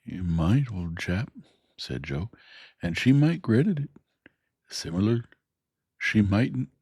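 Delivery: kindly, ponderous working man reasoning it out; Joe the blacksmith. Acted. Joe voiced in story.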